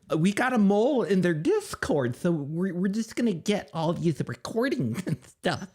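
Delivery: high mocking voice of Becca spilling a secret; mocking impression. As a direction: ham up a smug insider. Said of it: "She's like".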